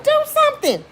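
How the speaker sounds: very high panicked holler; frantic retold scream; screaming plea. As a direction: holler it in desperation. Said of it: "I'm screaming and hollering".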